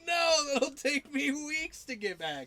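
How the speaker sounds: slow, laughing mock shout; laughing whine. Kid loses his game character, laughing shout. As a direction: wail it through laughter. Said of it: "And he's like".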